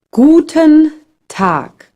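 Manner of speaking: Guten Tag said slowly, each sound clear; slow teaching model. Shown as a demonstration. Teacher models the greeting for a beginner, slow and clear. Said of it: "Pay attention to how G is pronounced".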